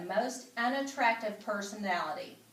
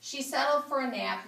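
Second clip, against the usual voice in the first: ordinary delivery, nothing marked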